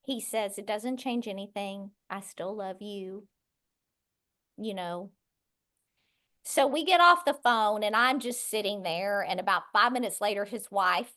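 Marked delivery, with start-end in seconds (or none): 0.6-5.1 much softer, lower and gentle; father comforting his daughter, quiet and tender; tender reassurance. Retold or imitated. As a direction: say it softly as a father's promise. Father reassures newly found daughter.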